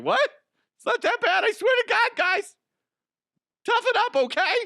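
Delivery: high, loud squeaky bravado; mock tough guy. Coach brushing off kids, squeaky loud bravado. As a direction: squeak out toughen up like a fake hard man. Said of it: "I'd be like".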